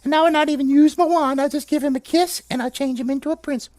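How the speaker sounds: high-pitched, dismissive fairy; tiny high fairy voice. Acted. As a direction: squeak it airily like an unimpressed fairy. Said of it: "And the fairy said".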